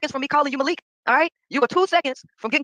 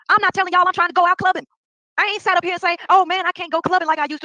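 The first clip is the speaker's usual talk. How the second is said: higher, louder, getting worked up; worked-up rant; 6 semitones higher, 3 dB louder than usual. Real, not acted. Venting to viewers, higher and louder. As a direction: push it up as the rant heats.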